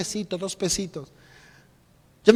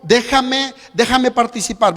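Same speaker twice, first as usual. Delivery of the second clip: pleading church; 3 semitones higher, 7 dB louder, slower than usual. Retold or imitated. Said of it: "le dice a la iglesia".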